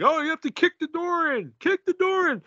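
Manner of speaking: high mock voice, pitched well up; a lightly voiced character telling him to kick it; mock urgent instruction; mimicking characters. Imitating characters in the film.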